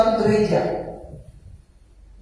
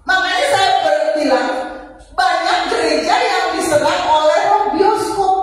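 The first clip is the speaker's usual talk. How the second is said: higher, driving preacher's exhortation; preaching; 6 semitones higher than usual. Acted. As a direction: press the point home to the congregation. Preaching climax on obedience.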